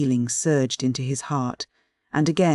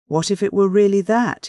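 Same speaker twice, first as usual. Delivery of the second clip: higher, louder dawning realization; reaching a conclusion; 6 semitones higher, 5 dB louder than usual. A narrator's reading. Man suddenly finding his own motive, as if concluding. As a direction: seize on a possible answer. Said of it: "as though reaching a conclusion".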